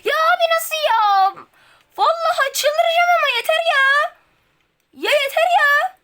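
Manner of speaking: about to lose it; furious outburst. Real, not acted. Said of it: "Bağıramıyorum". (çıldırmış öfkeli bağırış)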